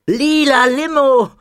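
high, slow, gleeful cry of delight; excited call. Acted. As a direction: shout it like a thrilled kid spotting soda. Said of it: "rief Muki". (Muki ruft begeistert, hoch und gedehnt)

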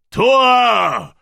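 roaring war cry to the sky; shout. A narrator's reading. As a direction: bellow it up to the heavens. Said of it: "rief er".

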